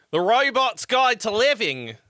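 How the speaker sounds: mock a Utah accent on the show's name. Streamer redoing the show title in a mock Utah accent. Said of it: "In my best Utah accent".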